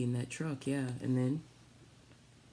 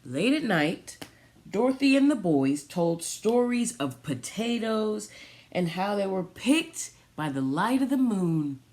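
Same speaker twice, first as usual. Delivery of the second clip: storybook reading; 4 semitones higher, 5 dB louder than usual. Acted. Reads picture book aloud.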